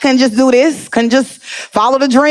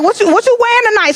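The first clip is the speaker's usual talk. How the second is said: friend calling; 9 semitones higher, faster than usual. Retold or imitated. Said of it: "You call up like".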